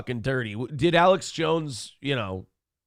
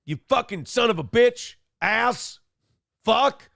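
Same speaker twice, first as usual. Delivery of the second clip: higher-pitched mock rant full of curses; imitating Alex swearing; 7 semitones higher than usual. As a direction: spit out the curses the way Alex does. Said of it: "I love the way Alex swears".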